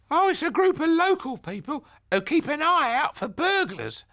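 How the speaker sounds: put-on high, loud gossiping old granny; a chatty grandmother grumbling about the neighbour. A narrator's reading. Grandmother gossiping to Ben about the nosy neighbour, high and loud.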